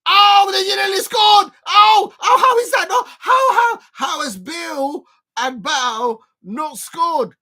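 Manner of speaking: very high, loud disbelieving yell; real shock as the team nearly scores; excited shout. Real, not acted. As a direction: scream at the near miss. Near miss, live reaction.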